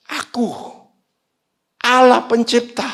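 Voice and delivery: high, rising to a loud declaration; God proclaiming. Acted. God promising to welcome his servant, emphatic high proclamation. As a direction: proclaim it with divine authority. Voicing God's promise in retold prayer.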